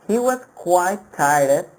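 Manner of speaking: He was quite tired, was lifted and slow; slow example read. Shown as a demonstration. Teacher reading the example sentence slowly. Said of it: "Let's have a look at the example".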